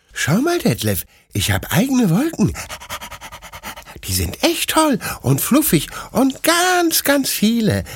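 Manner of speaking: high, eager little puppy voice, yipping; excited puppy Bruno. A narrator's reading. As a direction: bounce up and down like an overjoyed puppy. (aufgeregter kleiner Welpe, hohe Stimme)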